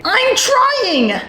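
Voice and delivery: very high, squeaky pupil; hammed-up high-pitched schoolboy fluster; distressed protest. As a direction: protest helplessly to your friends. Shushed pupil cannot stop talking.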